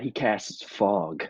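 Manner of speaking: ordinary talk, nothing marked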